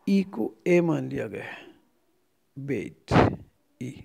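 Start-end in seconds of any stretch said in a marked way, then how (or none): none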